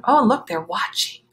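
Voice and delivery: ordinary delivery, nothing marked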